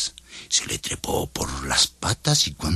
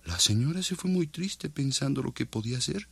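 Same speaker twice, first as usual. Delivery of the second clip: ordinary delivery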